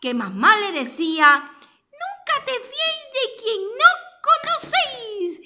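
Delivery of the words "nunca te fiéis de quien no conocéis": put-on high child voice with a laugh; a high giggly recital of the warning; little turtle voice. Narrator recalling the turtle's saying, high and giggly.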